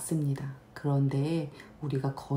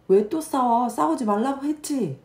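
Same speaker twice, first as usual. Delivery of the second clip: put-on high, loud scolding of the kids; scolding mom; 9 semitones higher, 5 dB louder than usual. Mom scolding kids who fight, high and loud. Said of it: "저도, 음, 아이들이 싸우면".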